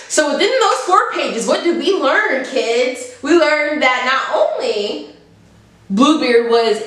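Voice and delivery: a sing-song classroom teacher asking the class; mock teacher to kids. Quizzing the audience like kids.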